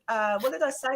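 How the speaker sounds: ordinary talk, nothing marked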